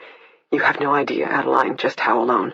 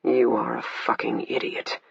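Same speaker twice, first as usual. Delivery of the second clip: low, soft menacing growl; 4 semitones lower, 5 dB quieter than usual. A narrator's reading. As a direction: growl the words under her breath. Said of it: "more like a growl than a sentence".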